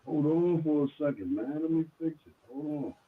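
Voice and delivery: muffled and faint; barely audible. Real, not acted. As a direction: voice buried behind bad audio. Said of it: "you sound muffled".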